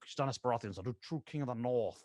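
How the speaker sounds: put-on Onion Knight style voice; Stannis Baratheon. Repeating his anchor line, put-on character voice. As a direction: slip into the anchor line's character voice. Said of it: "It's like my anchor for that voice".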